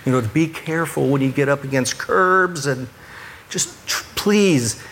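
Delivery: best calmest voice. Retold or imitated. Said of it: "my best, calmest voice".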